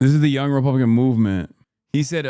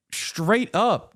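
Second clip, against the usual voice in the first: ordinary talk, nothing marked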